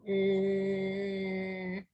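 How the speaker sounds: long, low hum on one note; hummed imitation of one-tone talking; monotone drone. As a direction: drone a level, lifeless hum. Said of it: "he talked like this".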